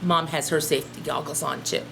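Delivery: ordinary talk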